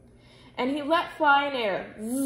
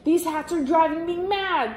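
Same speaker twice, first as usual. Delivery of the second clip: louder, higher frustrated king; ringing exasperated cry; 5 semitones higher, 4 dB louder than usual. A narrator's reading. King shouting through the palace in frustration, loud and high. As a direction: let it ring through the palace. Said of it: "the king's voice rang out".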